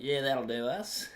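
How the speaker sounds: slow, laid-back bogan drawl; a lightly put-on lazy Aussie bogan; bogan baby boomer. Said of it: "Australian bogan baby boomer".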